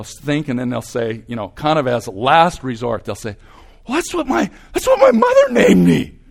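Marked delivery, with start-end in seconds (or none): 3.9-6.2 much higher, louder, laughing through it; mock the flustered student, giggling; high laughing imitation of a cornered student. Imitating a student's last resort.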